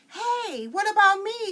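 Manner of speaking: high, loud left-out shout; calling out. Acted. Girl shouts after the others, left behind.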